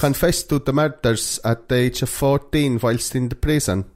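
lilting fake Finnish that sounds a bit Welsh; mock Finnish accent. Podcaster recounting a confession, wobbly Finnish accent. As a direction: keep up the bad sing-song Finnish accent. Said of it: "That sounds a bit Welsh".

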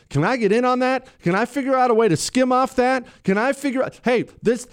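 high-pitched, eager mock hustler; mock schemer, pitch jumped up; scheming gangster. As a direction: voice a crook sniffing out a racket. Voices mobster hunting for a hustle.

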